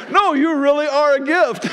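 high, playful, laughing insistence; teasing insistence. Retold or imitated. Pastor teasing Glenn after he shakes his head, playfully insistent. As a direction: tease him that he really is a gift. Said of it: "I'm like".

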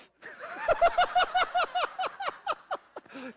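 high loud burst of laughter; big laugh. Real, not acted. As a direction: crack up at the punchline. Punchline of his own story.

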